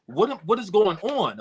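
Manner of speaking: high-pitched, baffled quote of own thoughts; bewildered self-questioning. Retold or imitated. Rapper recalling himself asking why he is crying, bewildered. Retells crying while rapping.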